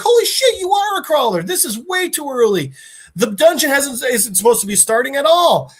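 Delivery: high, excited disbelief; astonished rat guy. Retold or imitated. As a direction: act out a flabbergasted creature, pitch starting way up.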